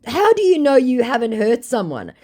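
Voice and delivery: high, loud re-enacted challenge; indignant challenge. Woman confronting a scammer over his victims, indignant.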